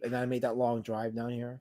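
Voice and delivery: ordinary delivery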